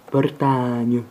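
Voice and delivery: Bretagne, last A said as E; Bretagne with final E. Shown as a demonstration. Teacher demonstrating a mock alphabet, Bretagne with final E. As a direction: give the last vowel of Bretagne an E sound. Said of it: "Et le dernier A se prononce E".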